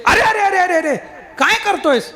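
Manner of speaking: startled and furious at the blow; outraged yelp of a struck man; angry protest. Acted. Namdev struck on head, angry.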